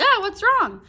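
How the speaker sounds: high, loud startled "what's wrong"; alarmed cry. Retold or imitated. Family hearing the old man cry out, high alarmed. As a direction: jump in alarmed and worried.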